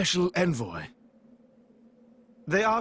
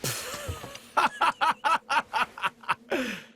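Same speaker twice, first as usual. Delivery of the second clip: amused laugh; 6 semitones higher than usual. Acted. Amused by hero's retort.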